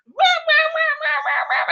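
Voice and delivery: mock baby crying. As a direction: squeal the baby's wah wah playfully. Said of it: "scrunchy face, like".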